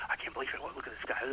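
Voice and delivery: hushed, disapproving whisper. Retold or imitated. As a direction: whisper it like a scandalized churchgoer. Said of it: "you hear him whispering".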